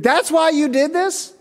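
put-on young worker questioning his boss, in a high incredulous voice. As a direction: squeak in high disbelief. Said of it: "It's like".

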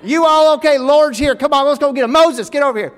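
high, loud country holler; hammed-up Southern rescuer shouting; Tennessee rescuer. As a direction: holler like an excited Tennessee local. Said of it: "You just hear the voice".

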